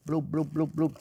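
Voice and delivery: string of little imitated bloops; playful bloop noises. Shown as a demonstration. Cook shows viewers his brie slicing, playful bloop noises. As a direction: make quick, light bloop sounds.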